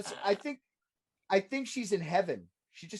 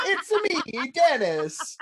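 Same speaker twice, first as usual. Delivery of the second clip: high, laughing Mario voice; laughing mock Mario voice; Mario-style voice; 8 semitones higher, 6 dB louder, much slower than usual. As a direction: play it like a video game plumber, higher. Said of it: "Mario and Dennis".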